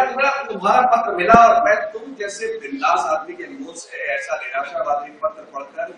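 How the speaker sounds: usual speaking voice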